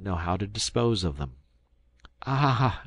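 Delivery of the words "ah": higher-pitched cry of delight; an enraptured boy's exclamation; ecstatic. A narrator's reading. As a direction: sigh it out in delight. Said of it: "Ernest was in ecstasies".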